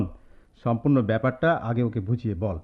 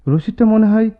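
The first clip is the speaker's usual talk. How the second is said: calling out; 10 semitones higher, 8 dB louder than usual. Acted. Calling out to roommate.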